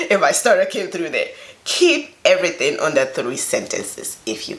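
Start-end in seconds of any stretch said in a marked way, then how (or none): 0.0-1.3 laughing through the words; amused at your own fumble; real laugh breaking into speech. Real, not acted.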